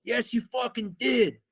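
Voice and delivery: slow, high-pitched angry accusation; drawn-out, outraged retold retort; freaking out. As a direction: imitate a furious kid in your face. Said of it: "starts freaking out".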